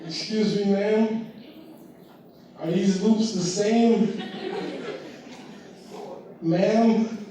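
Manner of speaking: put-on high polite little-kid voice; timid kid asking.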